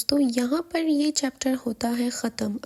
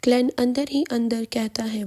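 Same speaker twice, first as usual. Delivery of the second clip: ordinary delivery, nothing marked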